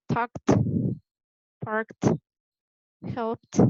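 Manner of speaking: "talked, parked, helped" each ending in a crisp t; voiceless -ed ending. Shown as a demonstration. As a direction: model "talked, parked, helped", one syllable each, closing on t. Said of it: "pronounce the E D as t".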